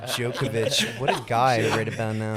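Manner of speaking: lightly put-on commentator voice, laughing through it; a hint of the Jim Courier commentary voice; Jim Courier impression. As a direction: imitate the commentator's catchphrase with a chuckle. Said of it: "the way you do that guy".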